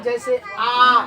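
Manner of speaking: आ drawn out slow and held; slow drawn out आ for the class; long vowel demo. Shown as a demonstration. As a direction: stretch आ to two beats. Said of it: "उच्चारण कीजिए आप लोग".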